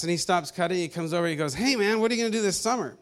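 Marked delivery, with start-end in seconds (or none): 1.5-3.0 quicker, higher, casual call across the yard; a hint of the friendly neighbor calling out; lightly voiced neighbor. Neighbor calling over the yard to the teen, lightly voiced, quick and high.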